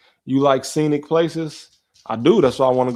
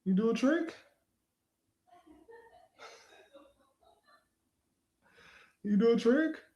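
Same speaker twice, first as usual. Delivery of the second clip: much higher and soft, asking the dog; squeaky questioning pet-talk; high-pitched dog voice; 14 semitones higher, 8 dB quieter than usual. Real, not acted. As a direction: gently ask the dog for a trick. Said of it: "a high-pitched voice".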